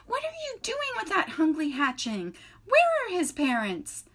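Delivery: high, loud indignant squawk; squawking goose. A narrator's reading. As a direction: scold the robot like an outraged old goose. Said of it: "squawking to the robot".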